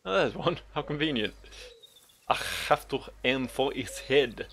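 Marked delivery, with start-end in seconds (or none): 2.3-4.5 slow, hammed-up French accent; attempted French accent. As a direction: put on a bad French accent with a heavy throaty H. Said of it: "That's my attempt at a French".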